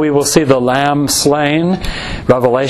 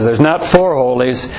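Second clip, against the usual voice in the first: ordinary talk, nothing marked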